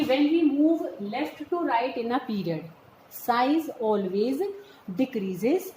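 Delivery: very slow, spaced-out note dictation. Real, not acted. Teacher dictating notes for students to write, slow dictation. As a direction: dictate slowly, pausing between phrases.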